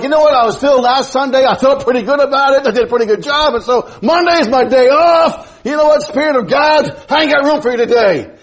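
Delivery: high-pitched imitation of a self-satisfied slacker; mock excuse-making. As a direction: play a believer brushing off God, pitched high. Acting out a believer making excuses.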